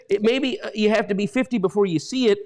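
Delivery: ordinary talk, nothing marked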